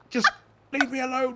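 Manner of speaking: put-on very high-pitched whiny kid voice; childish mock tantrum. Retelling mum ringing him, high mock tantrum. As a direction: play-act a child begging to be left alone.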